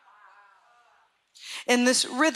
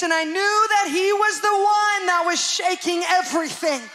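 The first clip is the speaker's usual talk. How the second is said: preaching at full force; 6 semitones higher than usual. Acted. Pastor retelling a prophetic dream to the church, preaching hard. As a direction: preach it to the congregation with mounting fire.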